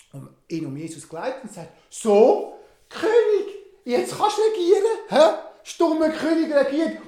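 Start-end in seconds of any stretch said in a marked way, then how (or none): 1.9-7.0 high, loud taunt through laughter; laughing mockery. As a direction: crown him as a joke, laughing. Herod crowns Jesus in mockery.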